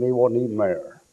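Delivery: ordinary talk, nothing marked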